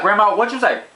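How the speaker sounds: demanding she repeat herself, shocked; high, pressing, shocked question; disbelieving question. Retold or imitated. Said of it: "I'm like".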